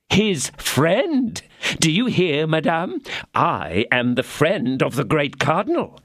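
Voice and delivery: high, proud bragging; lightly voiced smug mercer; boasting husband. A narrator's reading. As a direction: crow about his importance.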